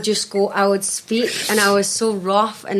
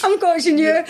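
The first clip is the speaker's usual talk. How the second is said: laughing mimic; 9 semitones higher than usual. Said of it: "mimics his reply".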